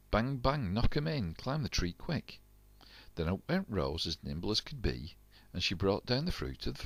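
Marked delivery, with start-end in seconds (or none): 0.1-2.4 brisk commands. A narrator's reading. Jack hammering pegs.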